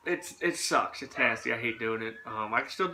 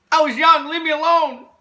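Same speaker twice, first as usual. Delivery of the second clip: high, loud protest; defensive shout; 13 semitones higher, 11 dB louder than usual. Real, not acted. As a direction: whine it loudly, half joking. Defensive joking outburst.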